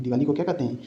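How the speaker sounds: ordinary talk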